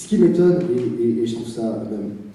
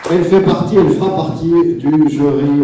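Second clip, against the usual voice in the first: louder host voice; 5 semitones higher, 8 dB louder than usual. Real, not acted. Host announcing prizewinner to crowd.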